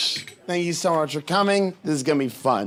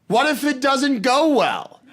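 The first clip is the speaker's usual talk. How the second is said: higher, slower, with a laugh in it; a mildly spooked sword swallower, drawn out; sword swallower reply; 6 semitones higher than usual. Retold or imitated. Sword swallower tells the comedian her fear, higher and slow.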